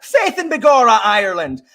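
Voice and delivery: high, loud mock Irish greeting; hammed-up Irish brogue calling a pupil; Irish accent. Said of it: "without doing an Irish accent".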